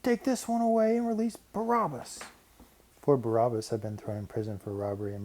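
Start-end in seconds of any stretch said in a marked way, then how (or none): 0.0-2.2 high, furious mob outcry; angry crowd cry. A narrator's reading. Crowd rejects Pilate's offer, high angry cry. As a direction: cry out in rage for Barabbas. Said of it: "Erupting with anger, they cried out".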